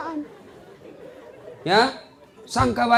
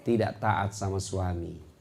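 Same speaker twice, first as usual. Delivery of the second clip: grave quiet; 8 semitones lower, 7 dB quieter, slower than usual. Real, not acted.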